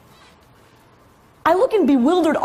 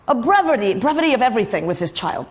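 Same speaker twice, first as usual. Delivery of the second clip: ordinary delivery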